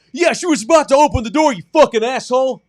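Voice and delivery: high, loud angry retort; imitated furious screaming. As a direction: yell back like an enraged dad. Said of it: "this weird guy who screamed at me".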